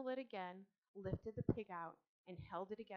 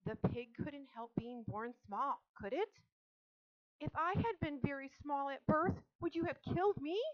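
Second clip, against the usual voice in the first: impassioned plea, rising higher and louder; crying out; 6 semitones higher, 7 dB louder than usual. A narrator's reading. Girl pleading the small pig's case, crying out. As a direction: build to a high, loud challenge. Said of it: "cried Fern".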